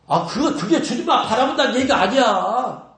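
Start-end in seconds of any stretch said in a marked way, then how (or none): none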